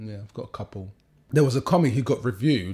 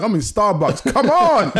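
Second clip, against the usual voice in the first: excited boast; 9 semitones higher, 7 dB louder, slower than usual. Retold or imitated. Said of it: "I'll be like".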